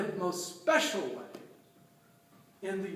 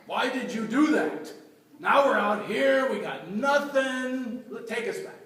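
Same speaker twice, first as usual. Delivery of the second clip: grumbling people; 2 semitones higher, 4 dB louder than usual. Retold or imitated. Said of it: "And they're grumbling".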